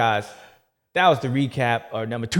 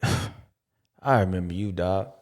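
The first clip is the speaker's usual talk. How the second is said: a hint of a lower, worn-out guy, lazy complaining; lightly voiced tired character; 3 semitones lower than usual. The caddy complains about Jay, a lower lazy grumble.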